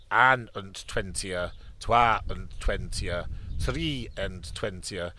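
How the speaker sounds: an and twentia, twa and twentia, three and twentia counted slowly; slow, careful recitation of Old English twenties; Old English counting. Shown as a demonstration. Host showing listeners how Anglo-Saxons counted, slowly. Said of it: "you say".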